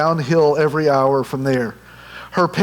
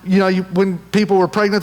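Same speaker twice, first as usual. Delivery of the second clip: ordinary delivery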